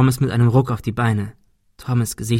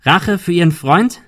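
loud, high rising question; challenging shout ending upward; calling out; 6 semitones higher, 5 dB louder than usual. A narrator's reading. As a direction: demand an answer, voice raised. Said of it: "rief Ben".

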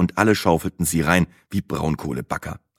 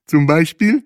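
high, loud curious question; curious character voice; interested; 10 semitones higher, 5 dB louder than usual. A narrator's reading. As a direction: ask with keen interest, pitched up. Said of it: "fragte Bahe interessiert".

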